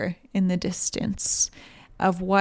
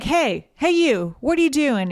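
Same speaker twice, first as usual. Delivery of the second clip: higher-pitched call after a stranger; 6 semitones higher than usual. Acted. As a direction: call out to someone getting away. Acts out calling after stranger.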